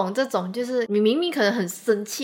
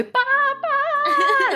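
put-on much higher, louder toddler calling for her father; little girl calling daddy; 4 dB louder than usual. Imitating the niece calling her dad back from the toilet, a high, loud toddler cry. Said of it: "他都会".

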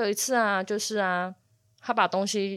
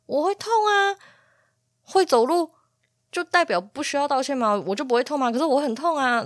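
high, louder, exasperated retort; indignant protest; 7 semitones higher, 4 dB louder than usual. Retold or imitated. Owner protesting to the cat that walking still hurts, indignant. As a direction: protest in a raised, high-pitched voice. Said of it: "我超不爽".

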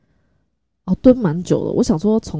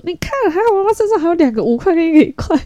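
higher and louder, delighted; cheerful relief; 12 semitones higher, 4 dB louder than usual. Retold or imitated. Mother telling her son she still has coins, higher and louder with delight. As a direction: tell him the good news with glee.

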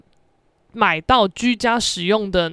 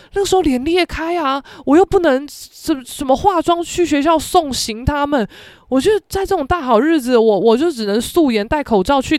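ordinary talk

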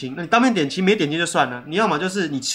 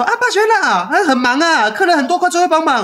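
much higher, louder calling out to her son; mom calling; 11 semitones higher, 4 dB louder than usual. Retold or imitated. Mother calling her son back to the busy counter, high-pitched calling. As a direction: call him back out to work, busy and bright. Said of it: "我妈就会说".

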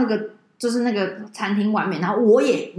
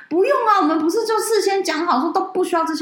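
high, raised protest; high-pitched indignant objection; elders protesting; 8 semitones higher than usual. Retold or imitated. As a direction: cry out in protest.

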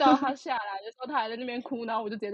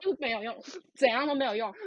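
ordinary talk

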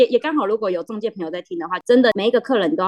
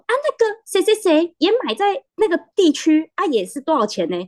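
higher-pitched, lightly voiced chatty old uncle; a hint of a village uncle, pitched up; old uncle's remark; 9 semitones higher, 3 dB louder than usual. Uncle gossiping with mom in the field, lightly voiced. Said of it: "另外一个阿伯就说".

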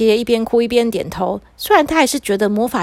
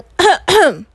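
throat-clearing double cough; loud, deliberate acted cough; two coughs; 7 semitones higher, 5 dB louder than usual. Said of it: "他就咳了两声".

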